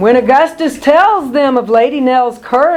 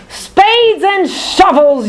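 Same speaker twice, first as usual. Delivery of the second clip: high, slow, strange mole voice; villain's yelp; 6 semitones higher, slower than usual. Acted. Odious Mole blinded by fireflies, high slow yelp. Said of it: "yelps Odious".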